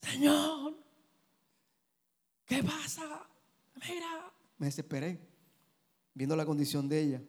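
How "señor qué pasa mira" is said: high, straining plea that fades to almost nothing; begging the Lord, pitched way up and dying away; retold pleading cry. Husband reliving his plea over his sick wife, high fading plea. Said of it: "grité como si estuviera peleando".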